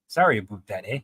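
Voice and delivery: Canadian "about" said as "aboot"; exaggerated Canadian apology; Canadian accent. Shown as a demonstration. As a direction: put on an amateur Canadian accent. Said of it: "do a Canadian accent".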